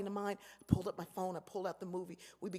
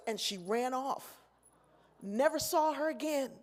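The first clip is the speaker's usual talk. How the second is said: usual speaking voice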